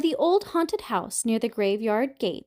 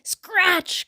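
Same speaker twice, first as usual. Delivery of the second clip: mother cat calling her kittens; cried out; 7 semitones higher than usual. A narrator's reading. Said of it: "cried the mother".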